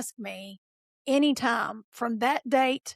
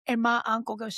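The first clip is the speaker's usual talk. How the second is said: usual speaking voice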